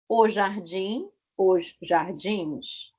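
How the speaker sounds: jardins drawn out slowly; careful teacher example; slow demo. Shown as a demonstration. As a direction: let the plural ending be heard. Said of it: "troca por N S".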